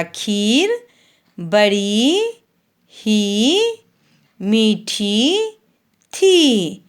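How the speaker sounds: slow, sing-song primer reading, long vowels stretched; reading to children. Acted. Teacher reads the Hindi primer to young pupils, slow and sing-song. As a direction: read each word slowly for the class to repeat.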